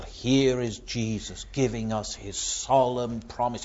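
ordinary talk

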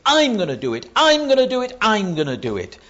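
loud stress on each "I'm", peaking on the second; emphatic, high, loud repetition led by "I'm"; insistent repeated I. Retold or imitated. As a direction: hammer out "I'm" every time. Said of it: "we have a whole string of I's".

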